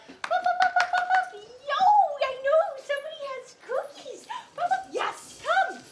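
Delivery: high, loud sing-song pup pup calling; happy puppy call. Shown as a demonstration. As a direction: coax the puppy in with bright high calls. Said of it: "choose some other way to get your puppy to come".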